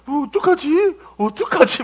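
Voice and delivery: high, panicky questioning; hammed-up timid fluster; mock trembling worry. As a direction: imitate someone fretting helplessly. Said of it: "막 벌벌 떨어".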